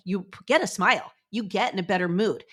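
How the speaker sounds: normal talking voice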